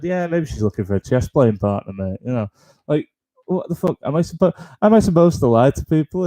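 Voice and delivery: ordinary talk, nothing marked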